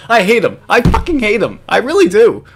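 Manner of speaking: high-pitched, exasperated venting; exasperated hatred. Real, not acted. Host venting about Jim, exasperated dislike. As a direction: vent it with rising exasperation.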